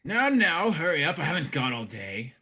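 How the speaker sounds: loud, high opening easing down; impatient brawler. Acted. Brawler waiting on the whispering pair, impatient. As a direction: hurry them along loudly.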